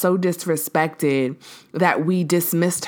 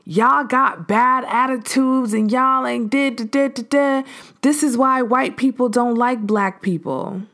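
mock angry man; 6 semitones higher than usual. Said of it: "he says something to the effect of".

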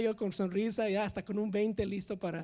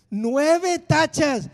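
loud, slow alarmed cry; 6 semitones higher, 11 dB louder, much slower than usual. Retold or imitated. Counting wrong answers on his driving test, loud and alarmed. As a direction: drag out the bad news loudly.

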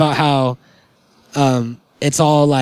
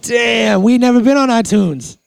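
high, hyped disbelief; hammed-up amazement; 8 semitones higher, 3 dB louder than usual. Host amazed to be on iTunes, hammed-up excitement. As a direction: marvel loudly in a raised pitch.

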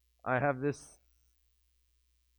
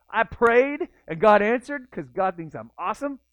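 higher and louder, smug self-praise; hammed-up smug bragging, higher and louder; mock boast; 6 semitones higher, 6 dB louder than usual. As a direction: ham up the smug prayer brag. Speaker mocks own boasting.

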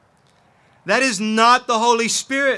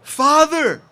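higher-pitched cry out to a father; 6 semitones higher, 3 dB louder than usual. Shown as a demonstration. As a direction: call out to God as your father. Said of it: "Cry out to him as your father".

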